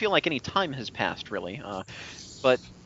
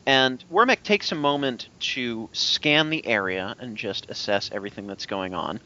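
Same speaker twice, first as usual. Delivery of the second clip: usual speaking voice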